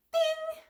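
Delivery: sung high bell ding; imitated oven ding. Imitating the oven timer going off, a high sung ding. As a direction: chime it out like a kitchen timer. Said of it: "like, a ding".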